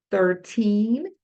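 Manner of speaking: put-on Thirteen with a long stressed teen and falling tune; clear teen. Shown as a demonstration. Teacher contrasts thirteen with thirty for learners. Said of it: "Olha só o treze".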